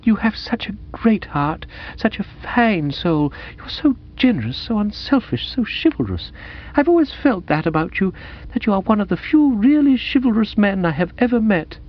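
high, earnest gushing heroine; soulful romantic young woman, admiring. Acted. Woman praising Bertie's chivalrous soul, high and admiring. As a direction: pour out lofty praise in a high voice.

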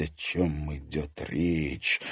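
ordinary delivery, nothing marked

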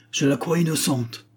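high-pitched, spirited declaration; energetic. A narrator's reading. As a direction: declare it with conviction. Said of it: "s'écria Agar avec énergie".

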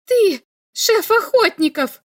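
high, loud accusing shout. A narrator's reading. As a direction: hurl the accusation. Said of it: "крикнула Ульма".

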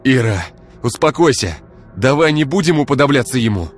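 loud, high pleading shout; restraining his girlfriend, shouting at her to calm down. Acted. Igor restraining Ira.

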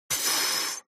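short voiceless puff of scorn; dismissive snort. Acted. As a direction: snort through the lips, pff. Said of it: "фыркнул третий ученик". (презрительное фырканье)

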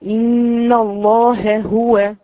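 slow, drawn-out إن الله هو with a clear ha; careful recitation demo. Shown as a demonstration. Teacher recites the correct form for her students. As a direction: intone إن الله هو slowly and precisely. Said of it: "دي بعض الأخطاء".

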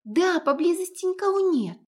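put-on high, loud excited girl; excited chatter. A narrator's reading. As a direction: blurt it out eagerly. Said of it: "протараторила возбуждённо Лиза".

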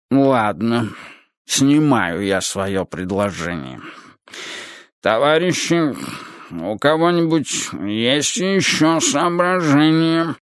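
slow, slurred chairman's voice; reluctant old leader. Acted. Leader withdraws his proposal, slow reluctant voice. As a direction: concede slowly, then open the floor.

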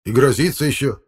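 high, still aggrieved complaint; calming protest. Acted. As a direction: complain as the temper settles. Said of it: "уже утихая". (обиженно, утихая)

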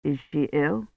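soft, anxious question. A narrator's reading. Priest asks after the child, anxious. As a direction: ask with hushed concern. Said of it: "he asked anxiously".

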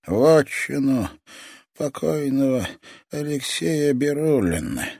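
put-on high, slow, sinister old toymaker; a menacing old man savouring every word; creepy old man voice. Old toy master speaks.

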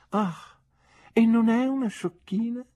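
an old aunt gently mocking; skeptical aunt. Aunt questions nephew about his sweetheart, arch aunt voice.

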